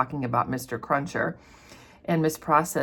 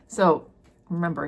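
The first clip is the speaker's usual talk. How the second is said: normal talking voice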